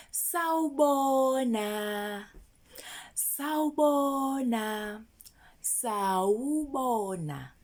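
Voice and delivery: slowed right down, each syllable of Sawubona spaced out. Shown as a demonstration. Teacher slowly modelling sawubona for kids. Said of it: "When you saying hello".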